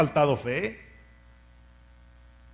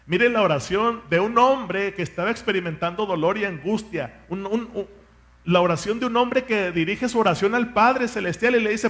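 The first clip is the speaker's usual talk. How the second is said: normal talking voice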